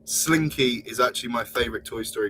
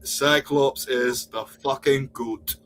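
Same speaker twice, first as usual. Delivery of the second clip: slow, hammed-up Scottish; butchered Scottish accent; much slower than usual. Friend mimicking the Scottish Cyclops fan, exaggerated Scottish accent. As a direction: stretch it out in a bad Scottish accent. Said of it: "I'm gonna butcher your accent".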